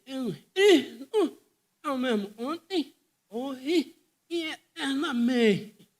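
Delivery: loud, drawn-out, fervent proclamation; Moody's declaration. Retold or imitated. Preacher re-enacting Moody proclaiming loudly from the platform. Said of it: "Mude foi de novo e disse assim".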